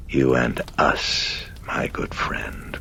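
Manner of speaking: slow demonic croak, fading into a whisper. Acted. Demon to the priest about joining it, a slow croak. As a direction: croak it very slowly, sinking to a breath. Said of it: "croaked the demon".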